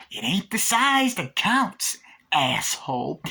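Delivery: high, slow sneering doll voice; killer doll taunt. Retold or imitated. Chucky mocks Damien with a high, slow sneer. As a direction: jeer it out like a nasty little doll.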